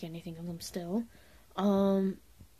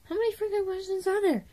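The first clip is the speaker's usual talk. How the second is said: exasperated disbelief; 12 semitones higher, 3 dB louder than usual. Retold or imitated. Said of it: "I was like".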